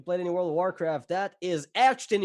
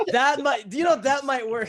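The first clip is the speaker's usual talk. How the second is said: laughing; 8 semitones higher, 4 dB louder than usual. Real, not acted. Laughing at shirt joke.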